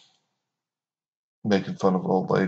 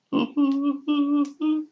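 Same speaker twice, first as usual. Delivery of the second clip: high, put-on goofy giggle; mock chuckle. Mocking the "just friendly" crowd, high fake giggle. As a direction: imitate their silly laugh, pitched way up.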